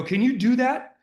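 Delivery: high, loud pointed challenge; challenging coach. Retold or imitated. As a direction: push him, ask it like a dare. Coach challenging player, retold.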